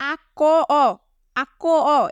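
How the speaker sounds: "আক ক অ" broken into ak, ko, o; added final vowel. Shown as a demonstration. Teacher showing what is not being said, vowel spelled out separately. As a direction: sound out the unwanted ending vowel in "আক ক অ". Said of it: "এটা বলছি না".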